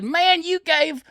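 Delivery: high, slow disbelieving complaint; stunned buddy's accusation; outraged friend. Retold or imitated. Fred outraged at confession.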